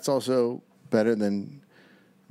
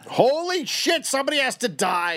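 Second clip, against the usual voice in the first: high, fast, excited exclaim; excited outburst; 14 semitones higher than usual. Acted. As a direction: blurt it out, thrilled. Acted outburst in the story.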